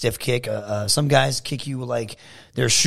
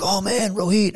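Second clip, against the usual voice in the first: higher-pitched awed hello; excited fan greeting; 8 semitones higher than usual. Retold or imitated. Newcomers greeting the veteran wrestler, excited. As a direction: greet him like a starstruck newcomer. Acted fans greeting him.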